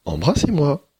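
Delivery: high, firm repeated demand; a high princess insisting; insistent princess. Acted. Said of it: "répéta la princesse Yang".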